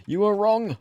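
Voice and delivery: high, loud taunting gloat; teasing gloat. Real, not acted. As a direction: rub it in playfully. Teasing a friend.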